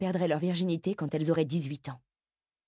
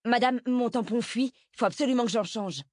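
high, pressing request; urgent plea; 6 semitones higher, 3 dB louder than usual. Acted. Pupil begs the sports teacher to let her go, urgent. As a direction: plead urgently, pitched well up.

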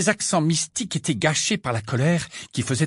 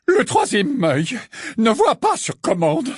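higher, louder indignant declaration; affronted, put her in her place; scandalised; 10 semitones higher, 4 dB louder than usual. A narrator's reading. Offended seer rebuking the inspector, scandalised. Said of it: "déclara-t-elle scandalisée".

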